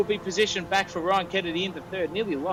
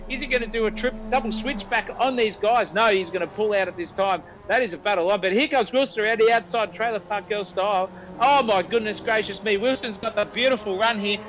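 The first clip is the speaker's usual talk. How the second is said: excited; 6 semitones higher than usual. Real, not acted. Commentator calls a close battle.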